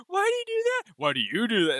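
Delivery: high squeaky mock question, then back down; mocking squeak. Parodying the character to a friend with a squeaky high question. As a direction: squeal the first ask and drop for the repeat.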